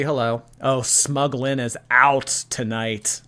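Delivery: ordinary talk, nothing marked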